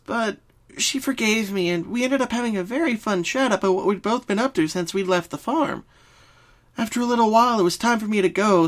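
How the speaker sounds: high, bouncy pony friend; Pinky voice. Acted. Pinky starts explaining her dreams. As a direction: answer like a cheerful pink pony.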